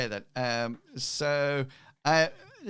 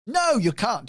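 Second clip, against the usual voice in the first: loud, high joking refusal; mock-outraged reversal, about to laugh; 9 semitones higher, 8 dB louder than usual. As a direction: burst out with a playful veto. Game master jokingly overturns his answer.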